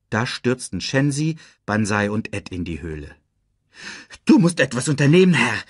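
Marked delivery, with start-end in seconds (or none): 4.3-5.6 hohes, hastiges Hyänenjaulen; jaulend. A narrator's reading. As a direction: schnell und schrill winselnd jaulen. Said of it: "jaute Shensi". (jaulende Hyäne, schnell)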